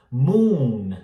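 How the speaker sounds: full, drawn-out oo in "moon"; long vowel. Shown as a demonstration. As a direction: hold a strong oo in "moon". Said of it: "é o U mais forte". ("moon" com U longo e forte)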